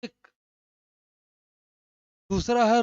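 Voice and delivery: ordinary delivery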